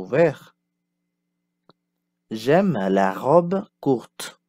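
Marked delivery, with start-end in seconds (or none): 2.3-4.4 slow, clear model sentence; slow teacher model. Shown as a demonstration. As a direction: lay it out slowly for learners. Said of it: "Passons au son O ouvert".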